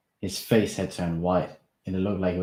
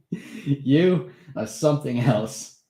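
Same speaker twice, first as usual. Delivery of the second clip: slow, higher, amused laugh in the voice; 7 semitones higher, 6 dB louder, much slower than usual. Acted. Steve laughing at Darren's daring. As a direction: marvel at him, laughing. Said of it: "he laughed".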